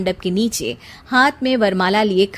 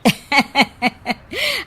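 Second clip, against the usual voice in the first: aunt's laugh; 5 semitones higher than usual. A narrator's reading. Aunt gossiping at wedding.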